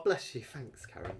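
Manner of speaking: usual speaking voice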